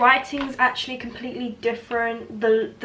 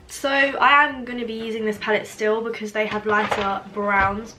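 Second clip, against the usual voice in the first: ordinary talk, nothing marked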